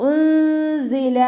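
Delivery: ഉൻസില with the nun hidden in a nasal hum, slow and high; ഉൻസില, nun concealed, z buzzing clear. Shown as a demonstration. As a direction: glide ഉൻസില through the nose into a clear z. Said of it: "മണിച്ചോതി കൊടുക്കുക".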